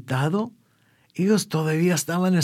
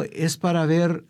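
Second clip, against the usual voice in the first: ordinary delivery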